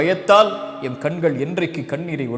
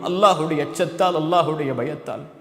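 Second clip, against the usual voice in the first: ordinary talk, nothing marked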